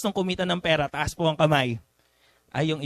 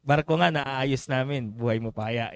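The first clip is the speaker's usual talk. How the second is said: ordinary talk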